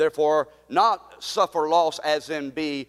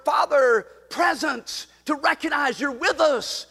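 fervent prayer cresting into cries; preacher's prayer at its climax; impassioned shouting prayer; 7 semitones higher than usual. Acted. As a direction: cry out to God with the church.

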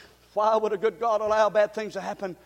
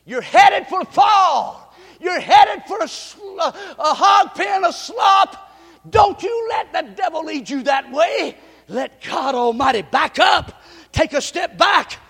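loud, climbing sermon cadence, hammering each line; preaching at full force; 6 semitones higher, 9 dB louder than usual. Acted. Pastor rousing the church, preaching at full force. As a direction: drive it home to the congregation.

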